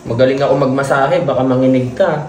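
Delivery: tender low voice. Real, not acted. Soothing sick younger sibling.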